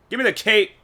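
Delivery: loud, high mock big spender; carrying on ordering. Joking restaurant customer ordering a drink, carrying on loudly. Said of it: "in the restaurant carrying on like that".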